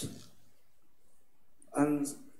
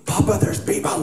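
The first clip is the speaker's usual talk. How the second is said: put-on excited child discovery; 6 semitones higher, 8 dB louder than usual. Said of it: "He goes".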